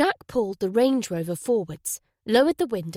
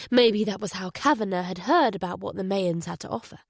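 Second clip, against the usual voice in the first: usual speaking voice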